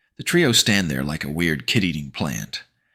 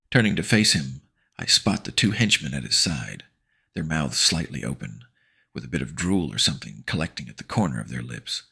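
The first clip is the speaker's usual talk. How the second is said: usual speaking voice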